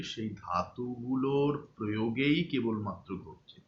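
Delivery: normal talking voice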